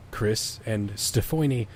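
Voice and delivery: usual speaking voice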